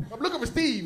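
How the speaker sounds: high and loud, barking at the door; a hired thug demanding someone; menacing hitman. Retold or imitated.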